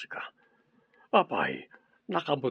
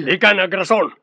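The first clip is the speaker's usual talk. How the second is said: angry rebuke; 4 semitones higher, 7 dB louder than usual. Acted. Boss angrily rejects excuse.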